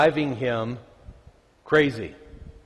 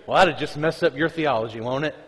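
usual speaking voice

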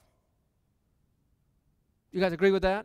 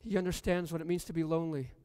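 hushed repeat, quicker; softer, hushed repeat; 3 semitones lower, 7 dB quieter, faster than usual. Shown as a demonstration. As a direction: drop the voice and say it again gently. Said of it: "repeated softer".

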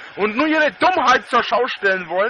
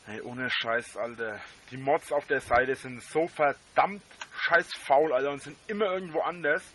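muttering; 8 semitones lower, 9 dB quieter than usual. Real, not acted. Muttered rant about lazy mods.

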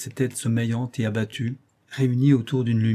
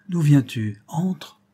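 urgent call; 4 semitones higher, 6 dB louder, much slower than usual. A narrator's reading. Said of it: "lui dit-il".

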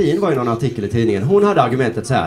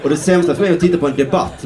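usual speaking voice

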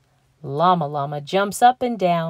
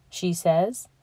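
plain reading voice

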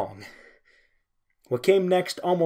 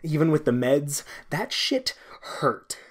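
plain reading voice

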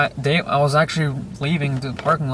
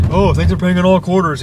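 higher, louder imitation of a cashier; mock cashier; 5 semitones higher, 7 dB louder than usual. Imagined McDonald's worker to quarter-paying customer, louder mock thanks. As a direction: put on an over-emphatic worker's thanks.

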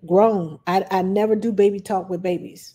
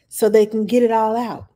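usual speaking voice

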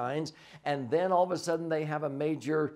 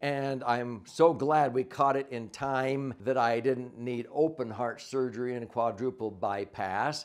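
usual speaking voice

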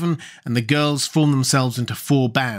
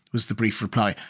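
ordinary delivery

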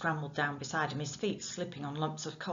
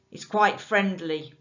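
menacing calm; 3 semitones higher, 9 dB louder than usual. Acted.

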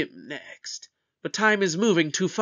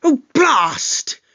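loud, higher, vexed exclamation; exasperated outburst; 5 semitones higher, 4 dB louder than usual. Acted. Doctor learning his suspects wandered off, exasperated outburst. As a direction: curse in sudden frustration. Learns guests have gone missing.

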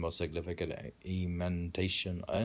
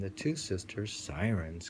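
ordinary talk, nothing marked

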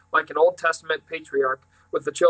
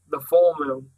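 matter-of-fact; 4 dB louder, slower than usual. A narrator's reading. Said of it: "matter of fact".

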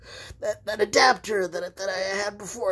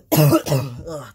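ordinary delivery

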